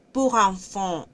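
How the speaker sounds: "Pour enfant" with lips rounded tight on "pour"; exaggerated French pour. Shown as a demonstration. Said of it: "este así como una cosita así". ("pour" con la boca redondeada)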